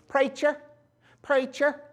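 high-pitched backwoods country call; backwoods local accent. Retold or imitated. Locals in the woods addressing their pastor, high country accent. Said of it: "you'll hear in these parts of the woods".

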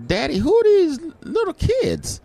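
put-on high childlike voice; little daughter. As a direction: play a small girl asking. Voicing young daughter.

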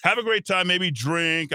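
high, excited mock crowd voice; defiant partygoers. Host voicing Amsterdam protesters to listeners, mock defiant partygoers.

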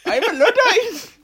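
put-on hoog, traag en lachend, overdreven noordelijk accent; mock northern accent. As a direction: speel een lachende staker met zwaar noordelijk accent. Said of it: "we're on a strike".

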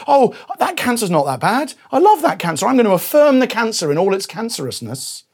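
lightly hammed-up fondness; mock cancer-lover. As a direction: gush over it sarcastically. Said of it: "If you say".

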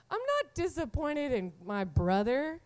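ordinary talk

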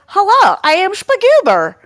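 loud, high, goofy character voice; big high-pitched dopey hello; cheerfully dumb gnome. Acted. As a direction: boom it out, gleefully dim, in a silly nonexistent accent. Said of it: "cheerfully dumb".

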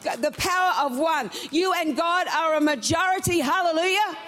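full-force preaching. Acted. Preacher to church about the certain woman, fervent preaching. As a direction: lift the congregation, press every line.

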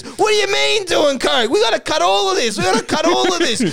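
high, frantic insistence; panicked protest. Acted. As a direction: act out alarmed damage control. Acted panic in story.

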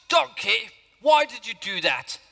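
usual speaking voice

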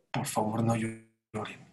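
a dying teacher softly comforting; gentle plea. Retold or imitated. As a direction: soothe the weeping students softly. Dying rabbi speaks to students.

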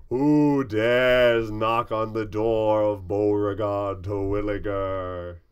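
loud, slow, booming gnome door voice. Acted. Gnome door booming at the knocking adventurer, loud and slow. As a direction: boom it out like a giant door face.

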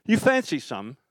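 imitating a madman rocker making an offer. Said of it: "and he goes".

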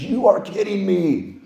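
slow, drawn-out astonishment; secretary's slow amazed gasp; stunned disbelief. Retold or imitated. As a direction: let the disbelief stretch out. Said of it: "She goes".

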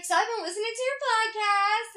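high, loud mom impression; effusive quoted confession; imitated gushing. Acts friend's mom's announcement.

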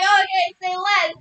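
loud, high, whooping delivery; retold hyped-up reaction; excited approval. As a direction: crow it loudly through the excitement. Acts out excited reaction to match.

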